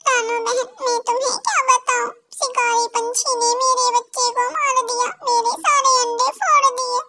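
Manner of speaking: tearful bird mother. Acted. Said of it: "एक चिड़िया बैठी हुई रो रही थी".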